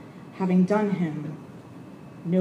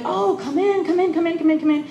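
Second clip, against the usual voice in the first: imitating an eager welcome, much higher; gushing mock invitation; happy welcome; 6 semitones higher than usual. Glad patient welcomes the chaplain in, higher cheerful imitation. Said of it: "some of them are really happy to see you".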